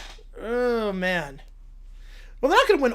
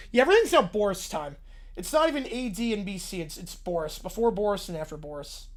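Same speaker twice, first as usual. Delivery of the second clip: usual speaking voice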